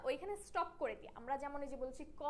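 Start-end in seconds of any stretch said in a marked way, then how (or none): 2.2-2.2 ক stretched on a long অ; drawn-out vowel. Shown as a demonstration. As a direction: draw ক out as a counter-example. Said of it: "এরকম আর টেনে না". (ক টেনে লম্বা করে)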